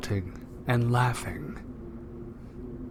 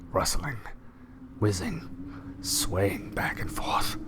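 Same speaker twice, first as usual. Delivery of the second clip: slow, breathy storyteller whisper; spooky whisper; 2 semitones lower, 6 dB quieter, slower than usual. A narrator's reading. Reading the eerie forest aloud, slow spooky whisper. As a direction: hush it like a ghost story.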